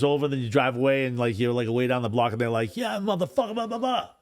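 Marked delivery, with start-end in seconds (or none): 2.8-4.1 high, half-volume mock taunt; mock yell. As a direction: mimic someone yelling from down the block. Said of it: "person that yells shit".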